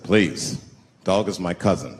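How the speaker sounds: low, deep, solemn; deep mock-solemn Native elder; stoic Native elder voice. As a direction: play a grave old chief, speaking slowly. Comic voicing of Native man.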